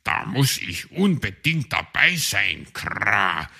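lightly voiced raven with a caw. Raven Schwarzrock speaks.

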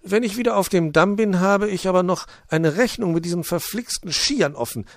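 high chirpy little bird. Acted. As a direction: chirp a greeting. Bird vows revenge on skis.

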